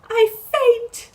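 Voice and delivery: very high, faint-coming-on wail; swooning cry. Acted. Character overcome as rapiers are drawn, high swooning cry.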